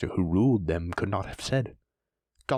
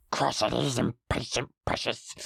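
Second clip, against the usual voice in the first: hissed Gollum. Acted. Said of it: "hissed Gollum".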